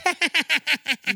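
high, silly little giggle; a tiny daft comedian's laugh; stupid tiny laugh. Retold or imitated. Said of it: "sort of stupid tiny little laugh".

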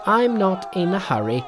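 high-pitched, cocky monkey voice; high, cheeky, confident Monkey; unbothered Monkey. A narrator's reading. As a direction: pitch it right up, taking his time.

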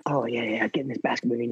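low, very soft aside; mock-casual boast. Athlete joking about practicing underwater weaving, low mock boast. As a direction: toss it off under your breath.

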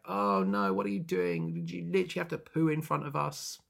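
mock-horrified onlookers; hammed-up disgusted dismay; aghast protest. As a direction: rise into appalled disbelief. Acted crowd aghast at squatting.

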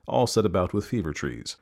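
plain reading voice

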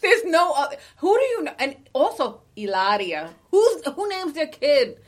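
loud, high-pitched exasperated outburst; riled up. Real, not acted. Cohost ranting over a woman's chosen name, riled up. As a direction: get worked up, voice leaping high and loud. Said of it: "I got really riled up".

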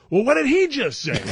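loud, high-pitched mock disbelief; comically bewildered, voice shooting up. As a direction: retell it as an exasperated squawk. Retold, escalating bafflement.

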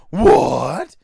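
very loud, rising cry of disbelief; shocked. Retold or imitated. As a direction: act out stunned outrage. Acted shocked reply to gun.

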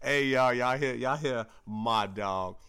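loud hype-man call-out; hype shout. Real, not acted. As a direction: shout it out to the crowd. Hyping up his co-host.

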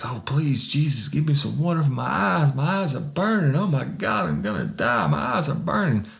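high, whining pleas in pain; desperate begging. Retold or imitated. Suspect begging the sergeant for water, high and whining. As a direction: beg like your eyes are on fire.

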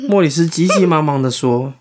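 ordinary delivery

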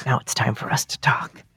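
hushed whisper to the listeners. Acted. Plotting a surprise with listeners while Dan is away, whispering. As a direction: whisper like plotting behind his back. Co-host gone, conspiratorial aside.

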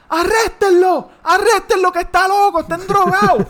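very high, loud mock scream; hammed-up hysterical woman; shrieking woman demanding arrest. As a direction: screech at the cops in her voice. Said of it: "Y ella está".

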